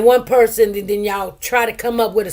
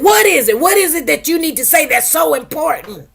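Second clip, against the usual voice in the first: impatient judge; 6 semitones higher than usual. Retold or imitated. Judge turning on her in court, impatient mock demand. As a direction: bark it like an annoyed judge. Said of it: "the judge turns around and said".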